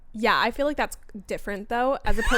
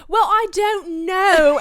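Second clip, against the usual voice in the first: put-on loud, high, drawn-out British accent; big theatrical British accent; slipping into British accent; 9 semitones higher, 10 dB louder, much slower than usual. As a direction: exaggerate a British accent, stretching it out. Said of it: "slip into this accent".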